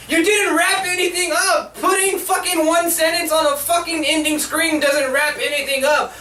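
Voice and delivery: high-pitched, exasperated complaint; outraged rant. Real, not acted. Reviewer furious nothing got wrapped up, indignant rant. Ranting at the unresolved ending.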